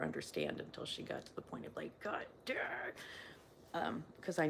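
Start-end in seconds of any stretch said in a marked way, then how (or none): 2.0-2.6 exasperated. Retold or imitated. Retells daughter's exasperated breaking point.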